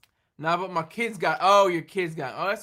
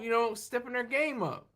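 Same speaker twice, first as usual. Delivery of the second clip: ordinary delivery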